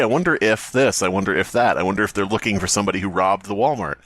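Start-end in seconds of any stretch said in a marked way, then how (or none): none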